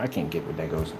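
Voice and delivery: ordinary talk, nothing marked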